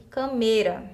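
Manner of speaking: "cameira" stressed on the middle syllable; wrong stress on câmera. Shown as a demonstration. Teacher reads out the misaccented word, "cameira" stressed in the middle. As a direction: say "cameira" as ca-MEI-ra. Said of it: "é uma palavra que a gente sabe que não existe".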